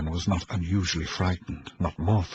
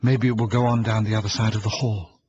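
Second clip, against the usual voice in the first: plain reading voice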